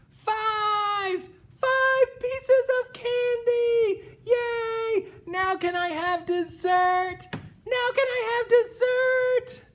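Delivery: high, loud singsong cheering; broad singsong kids theatre. Acted. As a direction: sing it out in triumph. Little P triumphant.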